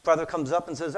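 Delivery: normal talking voice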